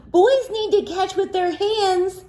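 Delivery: teacher. Acted. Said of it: "said the teacher".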